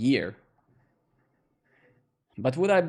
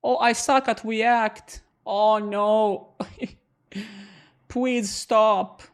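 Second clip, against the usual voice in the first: higher, louder hammed-up despair; mock dismay; 6 semitones higher, 4 dB louder, much slower than usual. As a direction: fake panic to tease the chat. Mock panic at viewer.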